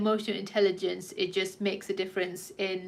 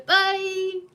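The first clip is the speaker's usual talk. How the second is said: bye shouted much higher and louder; cringy big goodbye; 11 semitones higher, 8 dB louder than usual. Acted. Vlogger signs off to viewers with a cheesy, loud, high bye. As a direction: sing out a deliberately cheesy farewell. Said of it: "I'm gonna do something very cringy".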